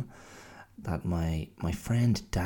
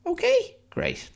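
very high, louder squeak on Okay?; squeaky falsetto okay; 4 dB louder than usual. Pretend. The other half of his self-chat, falsetto squeal. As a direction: answer yourself Okay? in a comic falsetto squeal. Schizophrenic conversation with yourself.

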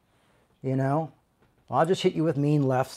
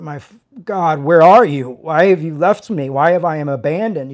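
louder, pleading cry to God; voicing Jesus calling out from the cross, louder; anguished cry; 10 dB louder than usual. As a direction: cry out in abandonment.

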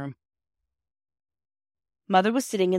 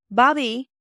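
higher and louder call up the stairs; 7 semitones higher, 7 dB louder than usual. A narrator's reading. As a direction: call her daughter from upstairs. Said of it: "called Mother's voice".

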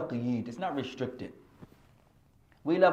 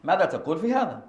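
questioning student; 6 semitones higher, 6 dB louder, faster than usual. Retold or imitated. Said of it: "قال".

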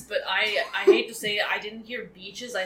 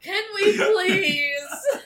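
laughing plea; 9 semitones higher, 6 dB louder, much slower than usual. Real, not acted. Pleading while laughing.

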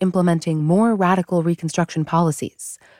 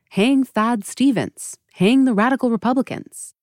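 higher-pitched, half-hearted yell of a speechmaker; mock shout; 6 semitones higher than usual. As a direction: imitate a rallying cry at a restrained volume. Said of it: "Johnson shouts out".

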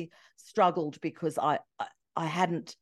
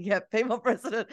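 laughing; 5 semitones higher than usual. Retold or imitated. Laughing while retelling polls.

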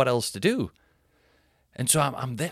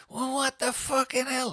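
very high, whiny mock outrage; tipsy Sophie; 13 semitones higher than usual. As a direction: imitate a drunk partner complaining in falsetto. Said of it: "she's had a drink".

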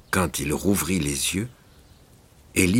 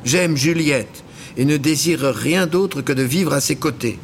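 put-on high, loud earnest young voice; pleading his love, high; young man Élie; 11 semitones higher, 5 dB louder than usual. A narrator's reading. Élie tells the vouivre he loves Juliette, high and earnest.

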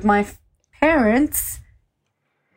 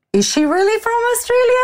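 squeaky high mock disbelief in a raised voice; pitched-up mock astonishment; incredulous mimic; 4 dB louder than usual. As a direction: play the stunned listener, way up high. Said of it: "they like".

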